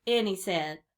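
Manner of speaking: ordinary delivery, nothing marked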